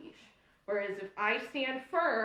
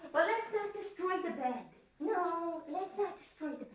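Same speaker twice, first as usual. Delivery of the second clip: playful scolding of the dog; 6 semitones higher than usual. Real, not acted.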